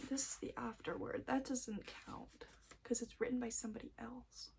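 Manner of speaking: hushed aside to yourself; very soft, whispered muttering; whispering. Real, not acted. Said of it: "I'm, like, randomly whispering".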